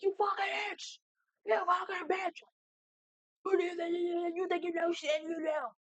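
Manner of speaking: high, strained mock shouting; imitated angry yelling. Brother yelling insults in your face, high mock shout. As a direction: yell insults in a high put-on voice.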